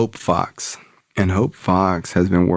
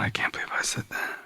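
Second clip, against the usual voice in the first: hushed, disbelieving whisper to oneself; shocked whisper; 12 dB quieter, faster than usual. Retold or imitated. Speaker to self after admitting addiction, stunned whisper. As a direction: whisper it under your breath, stunned.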